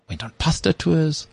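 normal talking voice

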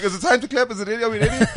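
high-pitched puzzled questions; unsure audience. Acted. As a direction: ask it as a confused crowd. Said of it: "people are like".

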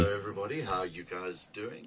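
far from the mic, barely audible; calling in from the wrong channel; faint off-mic greeting. Real, not acted. Host cuts in, mic off, faint greeting. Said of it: "Which one are you on?".

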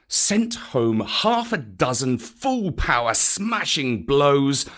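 ordinary delivery

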